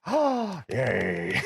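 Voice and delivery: high-pitched gasped "oh", then a small yay; mock swoon of delight, starting very high; delighted fan reaction. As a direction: act out a thrilled little fan moment. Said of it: "And I'm just like".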